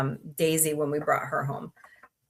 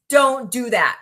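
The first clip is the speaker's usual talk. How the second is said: stern warning; 4 semitones higher, 7 dB louder than usual. Real, not acted. Warning viewers off methylene blue.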